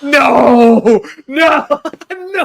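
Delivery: shouting no in horror at the news; shocked shouting. Real, not acted. Reacting to shocking movie news.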